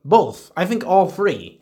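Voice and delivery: ordinary talk, nothing marked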